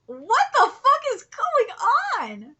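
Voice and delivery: loud, high, drawn-out disbelief; baffled outburst. Real, not acted. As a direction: stretch it out in loud bewilderment. Baffled reaction to the manga.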